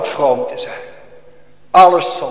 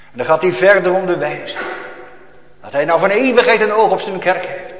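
usual speaking voice

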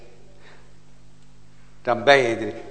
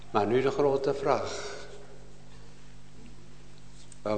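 soft pleading; 3 semitones lower, 4 dB quieter than usual. Acted. Preacher quietly urging the congregation to pray, softly pleading. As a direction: lower your voice and plead gently with each listener.

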